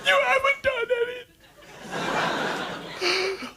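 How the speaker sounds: high falsetto woman's voice; crying wife in a squeaky high voice. Retold or imitated. Wife telling husband he did nothing, in falsetto.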